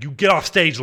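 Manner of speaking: a hint of a shouted order; mild mock shout. Narrator imitates [name] ordering the guitarist off stage, mild mock shout. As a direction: bark it half-heartedly. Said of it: "[name] shouted him down".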